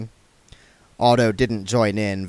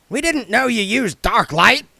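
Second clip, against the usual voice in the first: higher, louder dwarf snap; dwarf rebuking the vampire; snapped; 13 semitones higher, 5 dB louder than usual. Acted. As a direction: bite back accusingly. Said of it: "snapped Goodmountain".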